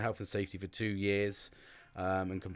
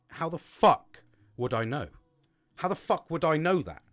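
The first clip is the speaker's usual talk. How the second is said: exasperated outburst; 6 semitones higher, 7 dB louder than usual. Retold or imitated. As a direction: throw the question back, exasperated. Retold own exasperated reply.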